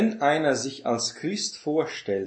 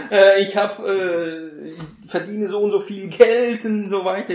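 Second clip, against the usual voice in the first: higher and louder mock bragging; hammed-up rich braggart, louder; mock money brag; 6 semitones higher, 8 dB louder than usual. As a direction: shout out your fortune, hammed up. Imagined quarrel, money boaster.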